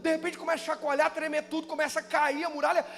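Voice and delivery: fast, high, loud pulpit build; impassioned preaching. Real, not acted. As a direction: rattle it out as the wall starts to crumble. Preaches at full force. (pregação inflamada, rápida e aguda)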